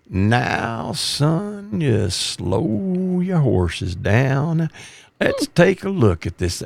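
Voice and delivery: put-on slow, soft, unhurried old lieutenant voice; calm veteran lieutenant. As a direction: settle the rookie down gently. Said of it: "And he'd say".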